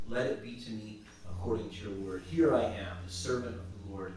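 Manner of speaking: soft, low and reverent; hushed, devout acceptance; humble surrender. Acted. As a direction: answer God as a humble servant. Mary answers God humbly.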